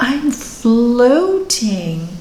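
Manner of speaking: very slow, stretched dreamy delivery; floating in peace. Retold or imitated. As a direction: draw each word out as if floating in trance. Retelling client floating peacefully in trance.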